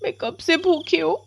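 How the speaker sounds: put-on high-pitched child's complaint; plaintive little girl. Hungry Komal tells the witch her mother locked her out, plaintive. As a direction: complain in a small, high girl's voice.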